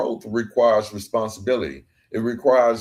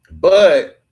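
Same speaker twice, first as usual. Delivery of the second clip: BUT thrown out much higher and louder; emphatic but; 9 semitones higher, 10 dB louder than usual. Acted. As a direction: hit "but" hard, as the turn of the whole sentence. Compassion's words, emphatic turn.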